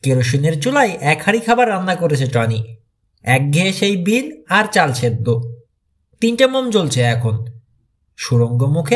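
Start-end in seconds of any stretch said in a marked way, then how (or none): none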